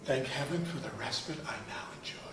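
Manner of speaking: fast, whispered thanks; a hushed sigh of thanks to heaven; relieved whisper. Acted. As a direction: breathe it out in relief. Relief after recalling insult.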